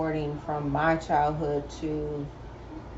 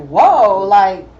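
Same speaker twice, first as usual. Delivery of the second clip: surprised; 4 semitones higher, 10 dB louder than usual. Retold or imitated. Reacting to daughter's baby voice.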